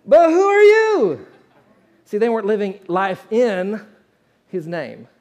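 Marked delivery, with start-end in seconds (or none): 0.1-1.3 high, loud, slow mocking demon cry; menacing demon. Demon mocking the would-be exorcists, high and loud. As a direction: let the demon sneer the question at them.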